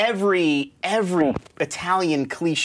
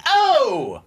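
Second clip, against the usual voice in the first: a hammed-up Italian mobster's "Oh!"; mock mobster; 8 semitones higher, 4 dB louder than usual. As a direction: throw out a loud, high, cartoon wiseguy "Oh!". Mock mob guy exclamation.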